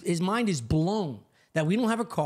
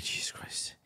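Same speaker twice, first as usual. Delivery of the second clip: hushed, exasperated aside, far softer; exasperated whisper; 11 dB quieter, slower than usual. Retold or imitated. Homeowner to himself as the neighbor rambles, exasperated whisper. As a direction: whisper it under your breath.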